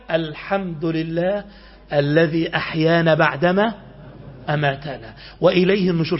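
low, slow, drawn-out recitation; prayer recitation. Shown as a demonstration. Preacher showing the morning prayer on waking, slow recitation. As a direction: recite the prayer slowly, letting each phrase stretch.

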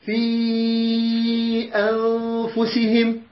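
في أنفسهم with the long vowel held four to five beats; stretched madd. Shown as a demonstration. Teacher showing students how to stretch the madd, slow and drawn out. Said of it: "تقول".

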